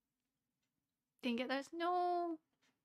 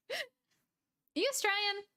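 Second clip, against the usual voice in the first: playful question; 9 semitones higher, much slower than usual. Real, not acted. Playfully questioning a chat viewer.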